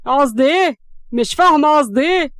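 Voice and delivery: loud, incredulous angry questions; angry echo. A narrator's reading. Siraj echoes the question of Hams, angry. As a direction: throw the question back heatedly.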